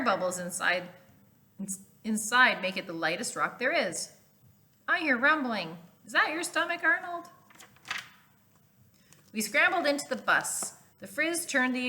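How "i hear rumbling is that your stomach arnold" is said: put-on teasing kid. Kid teases Arnold.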